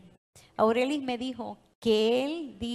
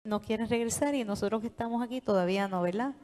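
normal talking voice

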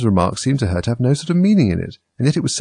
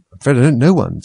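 flustered, higher and louder; rather alarmed; 5 semitones higher, 6 dB louder than usual. A narrator's reading. Alice asked for a story, rather alarmed. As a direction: back away from the proposal nervously. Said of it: "rather alarmed at the proposal".

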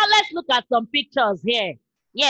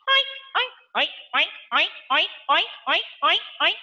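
pig oinks; 3 dB quieter, much faster than usual. Shown as a demonstration. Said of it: "like a pig".

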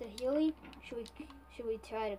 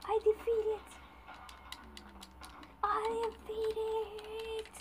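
high triumphant shouting; excited victory cry; 10 semitones higher, slower than usual. Real, not acted. Beating the Ender Dragon.

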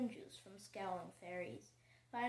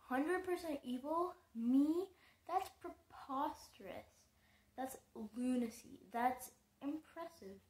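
musing; 5 semitones higher, 6 dB louder than usual. A narrator's reading. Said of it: "Annabelle mused".

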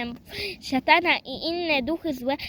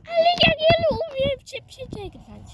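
toy's voice; 12 semitones higher than usual. Pretend. Voicing the toy Grzesiu.

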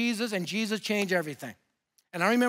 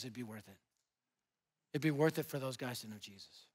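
much lower, much softer earnest reply; quiet earnest; 10 semitones lower, 12 dB quieter than usual. Retold or imitated. Doug telling the preacher it's worth dying for, quiet and earnest. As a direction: say it quietly, meaning every word.

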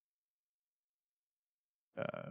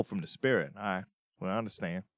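ordinary talk

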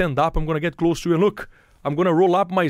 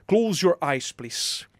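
ordinary talk, nothing marked

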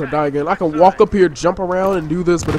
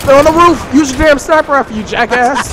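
loud, higher frustrated yell; angry yelling; 5 semitones higher, 7 dB louder than usual. Real, not acted. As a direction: bark it at a useless teammate. Angry callout in firefight.

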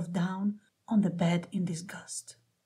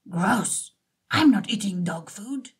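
barked; 3 dB louder than usual. A narrator's reading. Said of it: "Oliver barked".

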